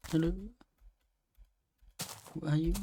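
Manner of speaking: ordinary delivery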